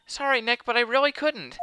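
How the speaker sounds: put-on high, apologetic girl voice; apologetic witness. Maya apologises to Nick.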